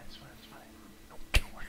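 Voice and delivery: hushed reassurance; whispering. Real, not acted. Player brushing off the whispering to friends, whispering. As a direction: whisper it as if hushing worries. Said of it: "I don't know why I'm whispering".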